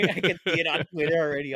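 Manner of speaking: laughing. Real, not acted. Laughing about imagined outrage.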